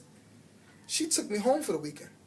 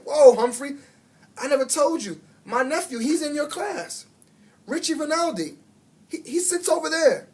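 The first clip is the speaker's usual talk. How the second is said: excited; 8 semitones higher, 4 dB louder than usual. A narrator's reading. Aldo excitedly revealing his nephew.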